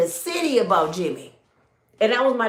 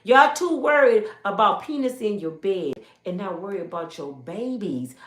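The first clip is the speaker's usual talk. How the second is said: normal talking voice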